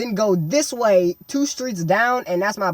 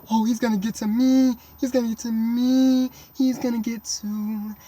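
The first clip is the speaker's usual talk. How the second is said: mock anxious hope. Kid watching bus near his stop, mock anxious hope. Hopeful chanting as bus nears.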